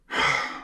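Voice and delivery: long tired sigh out; exasperated sigh; weary sigh. Real, not acted. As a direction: let out a fed-up sigh.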